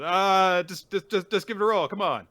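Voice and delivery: loud, high, stammered acted insistence; imitating an exasperated push to play; impatient urging. Acts character urging a die roll.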